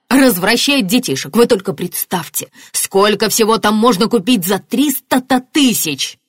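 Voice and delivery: indignant exclamation. A narrator's reading. Martin picturing the suspect's life abroad, indignant. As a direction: exclaim with growing outrage. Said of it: "воскликнул Мартин".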